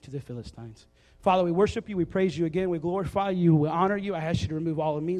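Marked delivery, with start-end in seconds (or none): 1.2-5.2 opening prayer. Acted. Pastor leads an opening prayer.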